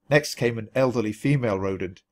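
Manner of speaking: plain reading voice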